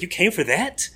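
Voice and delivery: a mock-incredulous English old man's accent; disbelieving question in an imitated British accent; British accent attempt. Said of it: "my attempts to do the accent".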